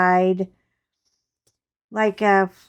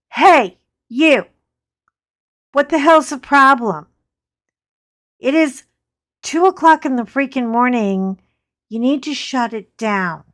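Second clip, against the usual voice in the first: much louder and higher, yelling down at him; retold yell; 4 semitones higher, 9 dB louder than usual. Woman yelling down at a cruel man at night, loud angry shout. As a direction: bark it from the balcony to shut him up.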